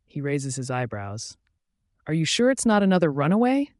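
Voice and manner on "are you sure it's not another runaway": higher-pitched taunting question; mocking. A narrator's reading. Alpha doubts she wasn't running away, mocking. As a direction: needle with a lightly mocking, raised pitch. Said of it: "he mocks".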